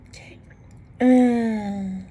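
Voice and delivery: catching a held-back sneeze; about to sneeze. Real, not acted. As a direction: gasp on the edge of a sneeze. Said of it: "Oh, I'm about to sneeze again".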